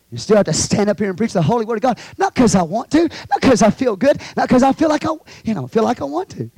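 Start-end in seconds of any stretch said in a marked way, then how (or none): 2.2-6.5 lively preacher's list with rising ends; animated preaching. Shown as a demonstration. Preacher lists reasons he doesn't preach, animated. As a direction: punch each reason, lifting the ends. Y'all like that?.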